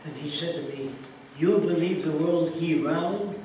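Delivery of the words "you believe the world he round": put-on louder, slow Middle Eastern accented question. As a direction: mimic the head guy's broken English, incredulous. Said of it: "That's his words".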